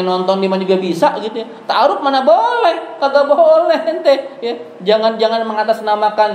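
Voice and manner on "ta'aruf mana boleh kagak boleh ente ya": slow, higher, laughing insistence; laughing slow emphatic no; emphatic denial. Real, not acted. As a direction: lay it down slowly with a laugh.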